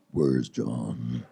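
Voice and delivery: very low, booming father voice; slow, deep mock-threatening growl; menacing dad. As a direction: drop way down into an ominous dad impression. Angry dad bursts in on boyfriend.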